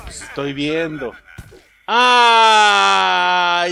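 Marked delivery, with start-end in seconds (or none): 1.9-3.7 loud, long-held "aaah"; drawn-out shouted exclamation; exclaiming. Real, not acted. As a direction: hold a big loud "ah" on discovery. Finds contraband in the car.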